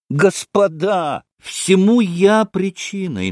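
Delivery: high, slow, emphatic address; earnest appeal. A narrator's reading. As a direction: appeal to the whole company. Mitya earnestly addresses the company again. (взволнованное обращение, протяжно)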